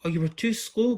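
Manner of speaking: mock the townsfolk playfully; girl giggling at the townsfolk she tricked. A narrator's reading. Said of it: "giggled Arabella".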